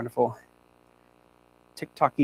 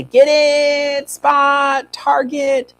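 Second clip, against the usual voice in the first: higher and louder release cues; louder cue words; 10 semitones higher, 7 dB louder, much slower than usual. Shown as a demonstration. Trainer showing cue words to viewers, calling them out. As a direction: call each cue out to the dog. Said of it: "You can say".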